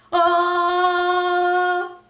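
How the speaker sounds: long loud held howl; gorilla roar. Acted. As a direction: roar like a chest-beating gorilla. Gorilla chest beating roar.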